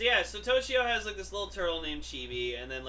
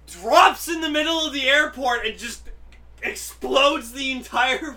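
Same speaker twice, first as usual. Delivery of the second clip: excited; 6 semitones higher, 9 dB louder than usual. Real, not acted. Excited retelling of scene.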